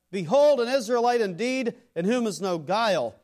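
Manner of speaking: preacher voicing Jesus praising Nathanael, loud and high; ringing proclamation. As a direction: proclaim it out over the congregation. Quoting Jesus from the pulpit.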